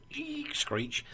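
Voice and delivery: high, soft imitated brakes squeal; mock tyre screech. Imitating a car braking to a stop. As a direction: squeak out the skid. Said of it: "It comes like this".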